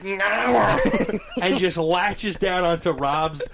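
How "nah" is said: higher mock chomp cry; snake's biting noise. Storyteller voices the snake latching onto a finger, mock snarl. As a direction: imitate an animal lunging to bite. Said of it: "And he goes".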